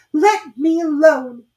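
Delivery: high, loud, slow and tearful plea; upset girl. A narrator's reading. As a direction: beg to be left alone. Alice pushes the pigeon away.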